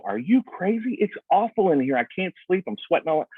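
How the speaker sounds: mock outraged disbelief; indignant homeowner. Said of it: "come back and go".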